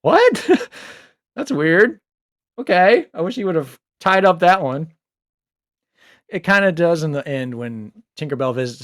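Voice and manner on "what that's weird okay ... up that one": mock bewilderment at a strange plot twist; baffled reader reaction. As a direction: react like a confused reader finishing the chapter. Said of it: "kinda like".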